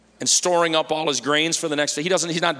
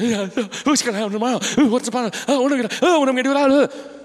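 high, fast, fretful worrier voice; preacher mocking anxious believers, high fast fretting; mock worry; 9 semitones higher, 4 dB louder, faster than usual. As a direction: ham up a panicking worrier.

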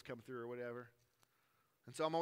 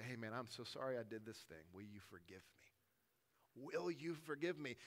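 sincere apology; 5 semitones lower, 6 dB quieter than usual. Shown as a demonstration.

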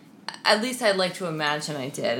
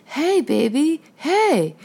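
much higher imitation of a doting dad; dad cooing to baby; 11 semitones higher, 4 dB louder, much slower than usual. Dad greeting his toddler in a home video, high cooing voice. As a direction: coo it like a parent getting a baby's attention. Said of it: "And he was like".

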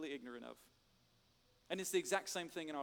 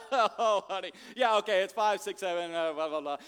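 hammed-up husband; 7 dB louder than usual. Husband voiced answering wife.

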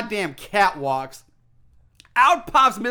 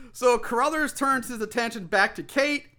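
usual speaking voice

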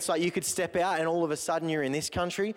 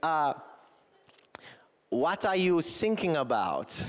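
slow, lightly done German coast guard; German accent; slower than usual. Retold or imitated. German coast guard replying to the sinking sailor, in a light German accent. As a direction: deliver the pun in a weak German accent. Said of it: "my German accent's not that strong".

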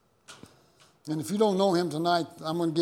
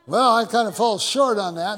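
louder, higher self-mocking cry; sheepish loud admission; 6 semitones higher, 10 dB louder than usual. Pastor admitting to his wife he fell short, loud comic wail. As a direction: exclaim it in comic defeat. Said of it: "And I said".

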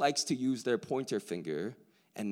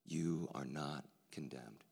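much softer, lower, slow; gentle reassurance; 9 semitones lower, 10 dB quieter, slower than usual. Acted. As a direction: lay it down tenderly for each listener. Jesus's words to listener, softly.

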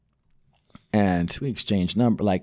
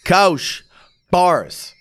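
loud, high hype shout; hype man shout; 9 semitones higher, 5 dB louder, much slower than usual. Pretend. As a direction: pump up the rapper. Hyping rap battle.

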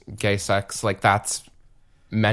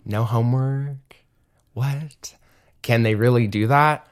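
surprised; 3 semitones higher, 3 dB louder than usual. Retold or imitated. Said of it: "I was like".

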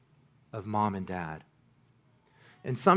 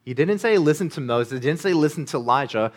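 louder, higher rising sermon climax; full-force preaching; 4 semitones higher, 8 dB louder than usual. Acted. Pastor urges the congregation that only Jesus comforts, preaching at full force. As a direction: drive the point home to the congregation.

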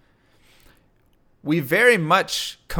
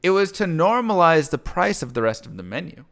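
ordinary delivery

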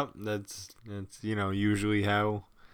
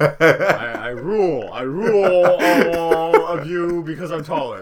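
louder, laughing through a playful brag; laughing mock boast; 5 semitones higher, 8 dB louder than usual. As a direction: boast like a giggling tyrant.

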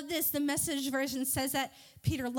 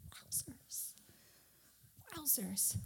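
barely audible, very soft wow; hushed amazement; 16 dB quieter, slower than usual. Acted. Speaker reacting to the wind stopping, hushed awe. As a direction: breathe it out under your voice in awe.

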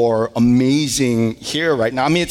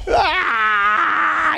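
put-on high wailing cry of a scared little boy; child's wail; 11 semitones higher than usual. Son with the lost tooth, wailing cry. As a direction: let out a short child's wail. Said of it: "And so he starts to wail".

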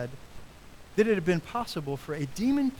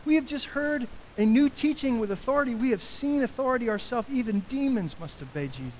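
higher, astonished voice of the crowd; amazed crowd; 8 semitones higher than usual. Retold or imitated. Crowd asking each other after the exorcism, amazed. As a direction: sound stunned by what you saw. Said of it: "They all asked".

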